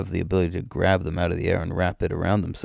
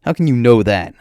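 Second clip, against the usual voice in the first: high, loud indignant question; challenging; 4 semitones higher, 7 dB louder than usual. Acted. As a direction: push back hard against the claim. Challenging Darafu's claim to the slaves.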